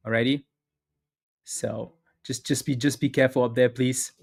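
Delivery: ordinary delivery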